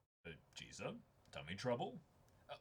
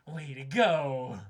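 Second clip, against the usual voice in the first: loud cheer; 11 dB louder, much slower than usual. Real, not acted. Cheering a friend.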